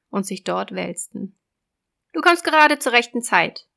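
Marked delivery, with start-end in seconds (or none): 2.1-3.7 loud, raised sea witch; a gloating witch, loud and high. Acted. Witch voice in fairy tale.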